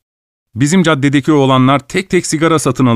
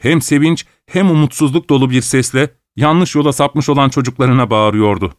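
ordinary delivery, nothing marked

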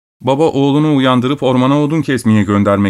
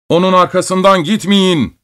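raised-pitch order to the troops; a lord's command, pitched up; 7 semitones higher than usual. A narrator's reading. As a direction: give it as a firm, higher-pitched order. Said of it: "diye emirler veriyordu".

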